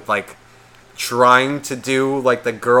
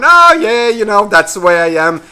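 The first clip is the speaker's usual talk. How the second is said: high, loud mock agreement; exaggerated put-on "oh, yeah"; mocking imitation; 7 semitones higher, 7 dB louder than usual. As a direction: parody him, starting way up high. Mocking the boyfriend's reply.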